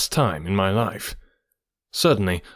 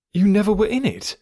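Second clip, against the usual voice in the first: high-pitched astonished question; incredulous; 10 semitones higher than usual. A narrator's reading. Listener startled that friend never fell into chasm, incredulous. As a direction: ask it in sheer disbelief.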